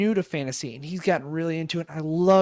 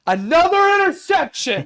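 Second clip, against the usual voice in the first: much higher, loud play call; excited announcer shout; 14 semitones higher, 9 dB louder than usual. Acted. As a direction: yell it like a broadcaster.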